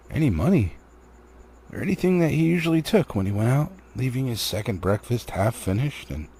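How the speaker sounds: ordinary delivery, nothing marked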